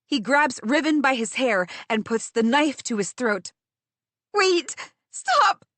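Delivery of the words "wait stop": desperate cry. Acted. Narrator begging the guard, a high desperate cry. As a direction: cry out to stop the knife. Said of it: "I cry".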